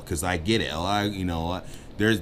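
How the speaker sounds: usual speaking voice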